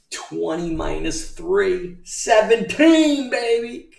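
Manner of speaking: high, slow gloating cheer; mock triumph. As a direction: sing it out like a win.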